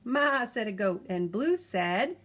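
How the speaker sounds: lively picture-book reading; reading to a child. A narrator's reading. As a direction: read it aloud to a toddler, bouncy and clear. Reads picture book aloud.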